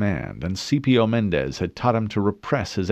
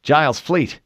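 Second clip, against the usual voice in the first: louder tense warning; urgent statement; 6 semitones higher, 5 dB louder than usual. A narrator's reading. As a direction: state it with alarm. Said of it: "Harrison stated".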